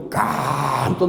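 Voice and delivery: slow, exaggerated 깜짝 for a big startle; drawn-out emphasis. Preacher telling how his friend was startled, drawn-out emphasis. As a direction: stretch the first syllable of 깜짝 long.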